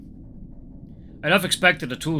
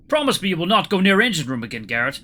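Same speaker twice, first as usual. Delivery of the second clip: put-on higher, louder Russian-accented demand; shred of anger; 6 semitones higher, 5 dB louder than usual. A narrator's reading. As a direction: demand a promise with contained anger. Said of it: "a shred of that anger slipped through".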